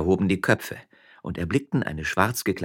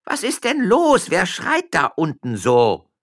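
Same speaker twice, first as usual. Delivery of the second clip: high, loud, slow annoyed call; 13 semitones higher, 8 dB louder, slower than usual. Acted. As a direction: yell down irritably. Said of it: "rief die Amme". (Amme ruft gereizt nach unten)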